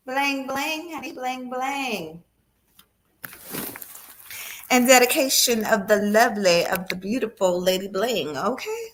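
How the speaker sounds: talking around a retainer; words slurred by a retainer; wearing a retainer. Real, not acted. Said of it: "I actually forgot that I had my retainer in".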